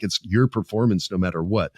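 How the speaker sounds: usual speaking voice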